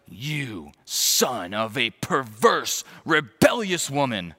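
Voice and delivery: very slow, each word leaned on; slow seething insult. Acted. Saul insulting Jonathan's mother, slow and seething. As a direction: drag out "perverse" with contempt.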